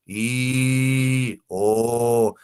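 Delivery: "I", then "O", each long and level; alternating held vowels. Shown as a demonstration. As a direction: stretch "I" and "O" on a steady note. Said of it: "pronuncio la I".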